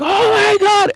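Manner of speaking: loud, very high re-enacted shout; imitating a hype explosion; hyped crowd yell. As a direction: yell it like a whole crowd erupting. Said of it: "everyone got hyped and we're like".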